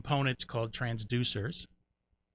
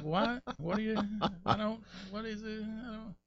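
naive confusion; 9 semitones higher, 8 dB quieter than usual. Retold or imitated. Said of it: "I'm like".

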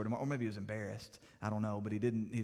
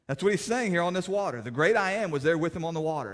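louder, higher pulpit proclamation; preaching at full force; 5 semitones higher, 8 dB louder than usual. Acted. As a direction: drive the truth home from the pulpit.

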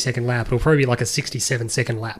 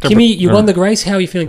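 higher, louder interviewer question; mock interviewer; 6 semitones higher, 8 dB louder than usual. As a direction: put on an eager reporter voice. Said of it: "That's someone who goes".

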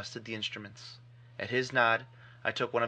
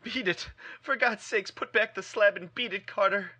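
much higher, desperate cry; piteous cry; 9 semitones higher, 5 dB louder than usual. Acted. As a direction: cry out pitifully for him to run. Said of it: "a piteous cry from Warren".

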